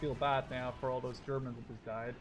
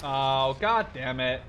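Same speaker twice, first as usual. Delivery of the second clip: loud, exasperated groan of a curse; frustrated outburst; 11 dB louder, much slower than usual. Real, not acted. Gamer cursing his botched bombing run, loud and exasperated. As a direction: swear at the ruined attack. Plan ruined in battle.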